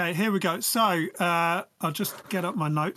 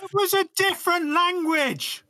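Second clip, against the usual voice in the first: exasperated protest; 10 semitones higher, 3 dB louder than usual. Real, not acted. Protesting co-hosts' doubts.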